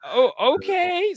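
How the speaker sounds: slow, high, drawn-out acceptance; acted baffled agreement. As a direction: stretch it upward, playing taken aback. Retold baffled agreement to child.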